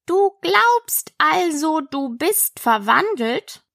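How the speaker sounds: ponderous caterpillar interrogation; languid caterpillar. Acted. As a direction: weigh out each word. Slow caterpillar resumes questioning.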